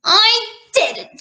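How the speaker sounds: put-on high child's denial; storybook princess insisting; little princess. A narrator's reading. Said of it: "said the little princess".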